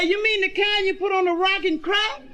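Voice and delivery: put-on high, excited child's voice; high little boy asking his daddy. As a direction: squeak it like a curious little kid.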